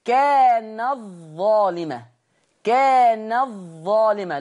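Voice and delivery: "كانت ظالمة" بإدغام التاء في الظاء ببطء; تُنطق "كانت ظالمة" بظاء مشددة لا أثر للتاء; كانت ظالمة بالإدغام. Shown as a demonstration. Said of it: "لا أثر للتاء". (تلاوة بطيئة مدغمة)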